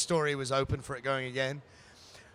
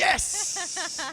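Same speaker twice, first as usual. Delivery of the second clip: excited yes; 14 semitones higher than usual. Real, not acted. Joking about offering a job.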